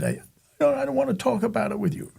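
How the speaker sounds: high-pitched reluctant kid, lightly acted; upset child. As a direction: act out a kid refusing to talk. Retelling himself as upset child.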